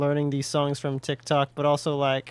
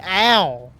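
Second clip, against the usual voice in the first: sharp ow; 4 semitones higher, 7 dB louder than usual. Real, not acted. Game damage, pop coral hurts.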